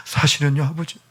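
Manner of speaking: put-on child sobbing an apology to his father; tearful confession. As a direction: apologise through tears. Child confessing while crying.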